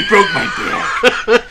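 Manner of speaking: high strained Bane voice; hammed-up Bane impression. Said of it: "that was Bane".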